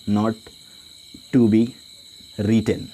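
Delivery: Not, to be, written spoken as separate pieces with long pauses; slow dictation. Shown as a demonstration. Teacher dictating a note for students to copy, word by word. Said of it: "यहाँ पे लिख सकते हैं".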